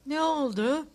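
a touch of sleepiness, rising at the end; sleepy reply. A narrator's reading. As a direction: answer as if just roused from sleep. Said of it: "uykulu bir sesle karşılık verdi".

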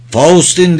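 shouting. A narrator's reading. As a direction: call after someone over helicopter noise. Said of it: "diye aykırdı". (yüksek sesle haykırarak)

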